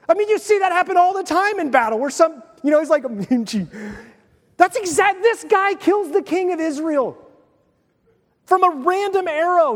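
high-pitched animated amazement; marvelling at the random arrow; excited. Real, not acted.

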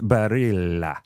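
drawn-out Italian "Barilla"; Italian accent. Shown as a demonstration. Co-host relaying a pronouncer, extended Italian accent. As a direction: stretch "Barilla" slowly like an Italian. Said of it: "imagine it like an Italian accent".

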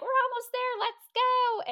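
high, loud rallying call; cheering her on. Retold or imitated. Reenergised friend pulling her hiking partner up the mountain, excited cheering.

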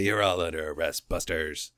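low, quiet cop voice; mock cop. Cops pulling up on the crooks, low mock authority. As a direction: drop low for a hammy police arrest. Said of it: "the cops pull up and say".

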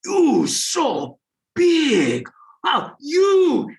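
high, drawn-out mock amazement; imitating Koreans. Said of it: "the Koreans on top would come up to me".

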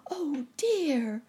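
very high cry of dismay; alarmed cry. A narrator's reading. Nurse Jane seeing the airship catch fire, high alarmed cry. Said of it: "cried Nurse Jane".

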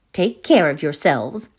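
ordinary delivery, nothing marked

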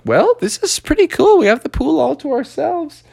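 high, chirpy little-character voice; pleased frog voice. Acted. Frog has the pool all to himself, high pleased voice.

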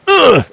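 sharp high grunt of pain. Acted. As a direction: grunt like you were just hit. GM voices grunt of struck elf.

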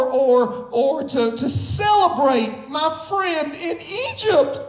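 rousing pulpit exhortation with shouts; preaching at full force. Acted. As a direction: drive it home to the congregation.

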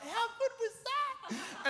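high-pitched, drawn-out mum impression; gushing mum. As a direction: squeal it slowly, like a thrilled mum. Acting out mum's delighted question.

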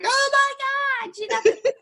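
very high, drawn-out imitation of an awed crowd; mock amazement. Waiting crowd hearing my score, mock amazed. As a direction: gush in a squealing high pitch, half laughing.

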